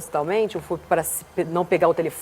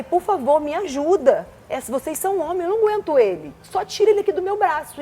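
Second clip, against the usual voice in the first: mock desperate plea; 7 semitones higher than usual. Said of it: "E comecei a chorar, gritando".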